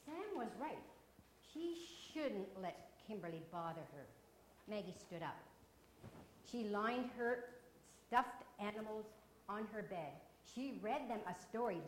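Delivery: lively read-aloud storytelling voice; story narration. A narrator's reading. Teacher reading a picture book to children, lively storytelling. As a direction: tell it like a picture book for the class.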